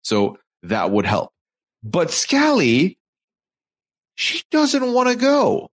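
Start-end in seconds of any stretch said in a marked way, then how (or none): 1.8-5.7 high, slow, drawn-out reveal; dramatic reveal. Real, not acted. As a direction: stretch out doesn't wanna go.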